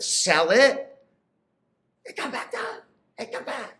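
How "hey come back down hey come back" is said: high and much softer, begging her back; soft pleading call. Acted. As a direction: call her home gently, higher and hushed. Pleading with wife astronaut.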